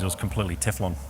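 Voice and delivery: ordinary delivery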